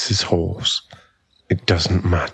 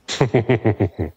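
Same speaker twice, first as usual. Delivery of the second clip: laugh. Acted. Ending taunt.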